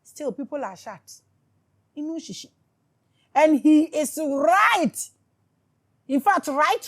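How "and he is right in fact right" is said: slow, loud, high declaration; emphatic shout. Real, not acted. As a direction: shout it out slowly, hammering each word.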